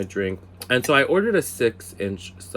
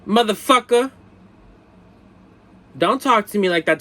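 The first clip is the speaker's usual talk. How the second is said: loud, higher comic telling-off; mock outrage; 10 semitones higher, 6 dB louder than usual. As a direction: playfully blow up at a flirt. Imagined outburst at flirting married man.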